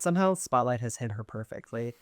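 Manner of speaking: ordinary talk, nothing marked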